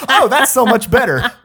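laughing sarcastic aside; laughing sarcasm. Real, not acted. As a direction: scoff through laughter.

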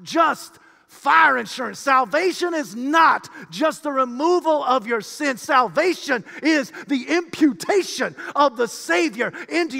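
high, loud, driving preacher's proclamation; full-force preaching. Real, not acted. Preacher proclaiming the great mystery to the congregation, full-force preaching. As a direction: preach it out at the top of your voice.

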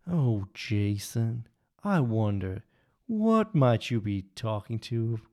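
lightly done slow Southern Texan drawl; a Southern Texan drawl, slow and dreamy; imitating dad's Texan drawl. Son imitating his father's Southern Texan drawl. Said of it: "let's see if I can try and channel my father".

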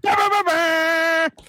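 slow, high shouted bah bah bah bah; loud noise. Acted. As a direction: belt out Bah, bah, bah, bah like a goofy horn. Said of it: "you're gonna make the noise".

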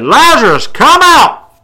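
loud, high shout. Acted. Jesus calling Lazarus from the grave, shouting. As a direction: call him out of the tomb at full voice. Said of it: "Jesus shouted".

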